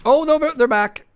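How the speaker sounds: high loud alarmed cry; panicked squeal. Real, not acted. Bugs return.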